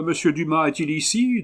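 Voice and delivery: lightly voiced common lad at the door; a hint of a rough servant asking for Dumas; vulgar voice. A narrator's reading. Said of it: "demanda une voix des plus vulgaires".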